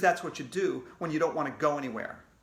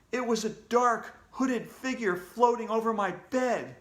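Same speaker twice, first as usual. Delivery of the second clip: plain reading voice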